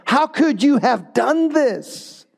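slow, high, stunned disbelief; flabbergasted. Acted. Sailors confronting Jonah in stunned, slow disbelief. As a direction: let the shock stretch each word out. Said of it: "They're flabbergasted".

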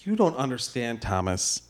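quiet confession. Acted. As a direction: drop into confession.

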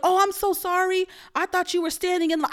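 mock apology. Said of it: "She's like".

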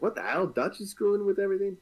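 high, fast outraged surprise; shocked disbelief. Retold or imitated. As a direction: sound blindsided by the betrayal. Imagined shocked player reaction.

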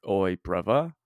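rough-and-ready attempt at British slang accent; British street accent. Shown as a demonstration. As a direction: do a quick British "oi, brother". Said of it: "'cause British, I can only go".

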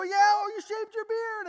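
high, loud excited exclamation; excited students. Retold or imitated. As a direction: burst out at the sight of him.

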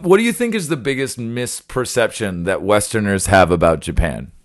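ordinary delivery

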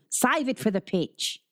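Australian coach breaking up a fight; ringing Australian call. A narrator's reading. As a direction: call it down the hall to the boys. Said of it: "a voice suddenly rang down the hall".

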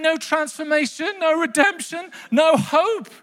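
indignant disbelief. Acted. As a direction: protest as if cheated by a story. Acted outrage at the book's ending.

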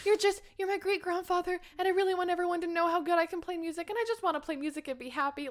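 put-on high, quick, earnest child voice; sweet pleading boy. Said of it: "he's so sweet".

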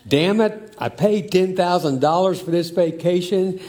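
dad yelling at his family about the vacation, mock angry. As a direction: bark it like a fed-up father on the beach. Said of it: "a dad was overheard yelling".

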